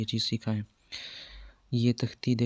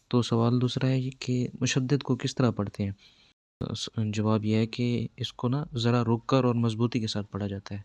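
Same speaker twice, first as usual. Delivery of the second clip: ordinary talk, nothing marked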